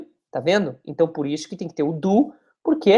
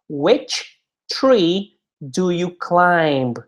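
"Who do they swim with?" said at half speed, each word spaced; slow teaching model; much slower than usual. Shown as a demonstration. Teacher models the question slowly for the class, drawn out. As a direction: stretch every word out for learners. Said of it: "slow model question".

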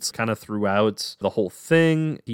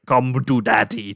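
slow growling demon voice; growling distortion voice. Retold or imitated. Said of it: "that growling distortion voice going like".

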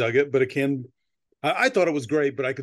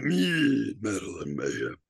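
croaky evil voice; 4 semitones lower, 4 dB quieter, slower than usual. Real, not acted. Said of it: "It's supposed to be evil on my end".

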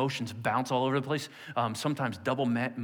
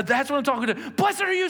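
high, loud, gleeful teacher voice; excited teacher; 10 semitones higher, 4 dB louder than usual. Retold or imitated. A teacher cheering a pupil's right answer, high and gleeful.

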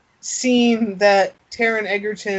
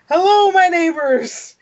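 high, loud, beaming hello to the street; cheery greeting; 9 semitones higher, 5 dB louder than usual. Retold or imitated. Akeem greets his New York neighbors, hammed-up cheer. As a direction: greet the whole block with delight. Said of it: "he's like".

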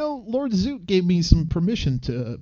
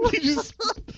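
laughing. Real, not acted. Laughing at spilled drink.

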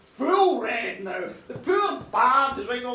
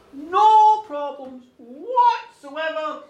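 drawn-out, loud "No problems whatsoever"; "No problems whatsoever" declared slowly and firmly; emphatic assurance; 10 dB louder, much slower than usual. Acted. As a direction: stretch "No" long and loud, then "whatsoever". Woman's over-firm reassurance to king.

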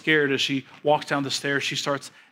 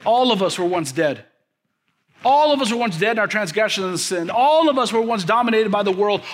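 rising, louder preacher's cadence; preaching; 8 semitones higher, 4 dB louder than usual. Acted. Pastor preaching to his congregation, building fervor.

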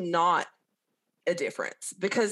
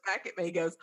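ordinary delivery

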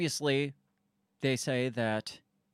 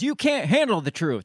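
loud, higher Jack Nicholson yell; imitated yell; 5 semitones higher, 5 dB louder than usual. Host quoting Jack Nicholson on the stand, a loud imitated yell. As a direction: belt out the famous movie line. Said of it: "And he yells".

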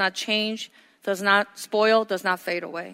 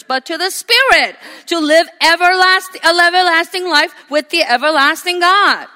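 loud, ringing sermon delivery; preaching at full force; 4 semitones higher, 7 dB louder than usual. Acted. Pastor preaching to his congregation, loud and ringing. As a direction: preach it out to the whole room.

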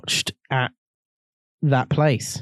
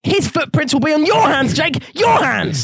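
melodramatic accusation; 10 semitones higher than usual. Pretend. Repeats mock dramatic accusation.

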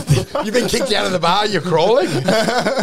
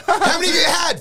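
mock partner; 10 semitones higher than usual. Mocking partner's question.

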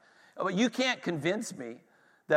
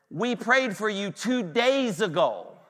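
"We prayed for you two days ago" drawn out slowly, pitched up; emphatic rebuttal; 5 semitones higher, slower than usual. Retold or imitated. Preacher answers the woman's old test results, "two days ago" slow and emphatic. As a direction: stretch "two days ago" slow and high to drive home the contrast.

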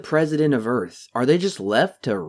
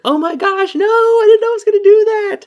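high-pitched, louder panic as the door slams; mock alarmed yelp, far higher; panicked quote; 9 dB louder than usual. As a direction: squeal it like you just trapped yourself. Worker trapped as door shuts.

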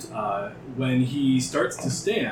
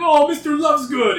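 high, loud anxious call; 14 semitones higher, 9 dB louder than usual. Acted. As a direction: shout after the runaway cat. Doctor calls out after his cat.

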